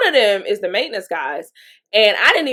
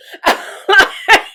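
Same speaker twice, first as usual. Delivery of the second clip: high airy burst of laughter; bright breathy giggle; 11 semitones higher, 6 dB louder than usual. Real, not acted. Laughs at her own story.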